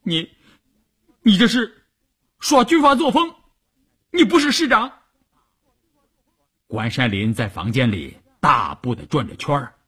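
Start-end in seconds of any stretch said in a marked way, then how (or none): 0.0-5.0 high-pitched, hurt accusation; aggrieved protest. A narrator's reading. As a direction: protest as if wronged and close to tears. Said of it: "邵月委屈的眼圈都红了". (委屈地高声顶撞)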